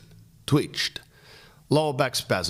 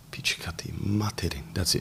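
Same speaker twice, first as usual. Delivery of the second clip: whisper; 3 semitones lower, 5 dB quieter than usual. Real, not acted. Muttering to himself off-mic.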